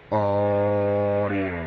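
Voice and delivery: sideband sound on an AM receiver; a drawn-out test word turned into mush by the radio; garbled radio. Real, not acted. Sideband test call received as garble on the old radio. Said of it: "you're just gonna hear the garbled jumble".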